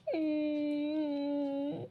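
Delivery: a soft muffled sob; quiet sob. Acted. Daughter breaks down to her mother, quiet sob. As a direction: choke back a quiet sob. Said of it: "ಸುಮ್ಕಿರು, ಅಳ್ಬೇಡ".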